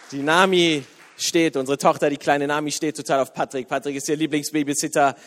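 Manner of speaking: usual speaking voice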